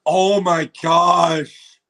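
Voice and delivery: very slow, loud drawn-out oh my gosh; overwhelmed, drag it out; stunned awe. Real, not acted. Watching the trailer on stream, slow stunned awe. Reacting to reveal.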